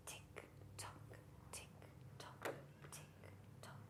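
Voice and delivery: whispered, evenly spaced clock ticks; tiny, measured whispered beats; ticking clock. Acted. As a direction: whisper each tick like a clock.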